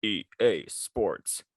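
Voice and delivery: low, slow sports-game tagline; deep announcer voice. Shown as a demonstration. Podcaster doing a game slogan on request, deep voice. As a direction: drop deep and draw out the slogan. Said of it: "you gotta get the deep voice going".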